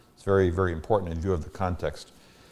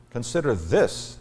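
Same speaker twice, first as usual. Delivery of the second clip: THIS hit louder and higher; slow down and punch "this"; stress on THIS; 5 semitones higher, 5 dB louder, slower than usual. Acted. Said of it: "emphatic emphasis".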